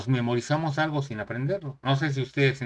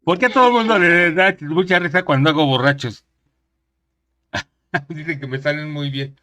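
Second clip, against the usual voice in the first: laughing; 4 semitones higher, 8 dB louder than usual. Real, not acted. Laughing about drunk impression.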